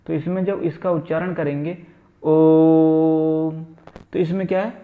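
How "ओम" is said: ओम held long, louder, in a steady tone; prolonged om. Shown as a demonstration. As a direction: stretch ओम to three times a short vowel. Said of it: "तीन गुना समय लग रहा है".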